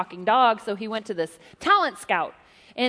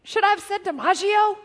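high, puzzled little dog voice; an innocent dog asking; cartoon dog voice; 8 semitones higher, 4 dB louder than usual. The dog asks its owner, punchline, high cartoon voice.

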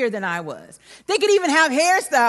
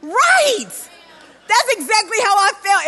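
exclaiming; 7 semitones higher, 4 dB louder than usual. Real, not acted. Exclaiming at the punchline.

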